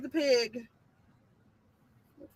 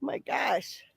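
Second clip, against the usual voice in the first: ordinary talk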